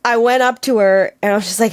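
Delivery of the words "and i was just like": ordinary talk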